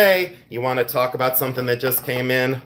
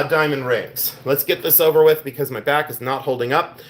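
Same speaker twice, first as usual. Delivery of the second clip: ordinary delivery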